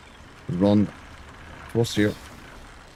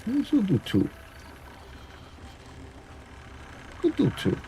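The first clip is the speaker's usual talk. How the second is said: squeaky high voice; 11 semitones higher, 7 dB quieter than usual. Acted. Gamer chirping to himself about his build in a squeaky high voice. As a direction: jump way up into a tiny high-pitched voice.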